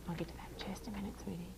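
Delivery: quick whispered aside; whispering. Real, not acted. Aside to child.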